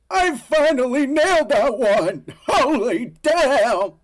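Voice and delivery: real cheer after nailing the take; triumphant shout. Real, not acted. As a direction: whoop with relief and laughter. Celebrates a good take.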